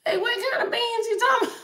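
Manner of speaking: high, fast put-on voice of confusion; squeaky teasing which-beans question; mock bafflement. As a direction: fire it back high and quick, playfully lost.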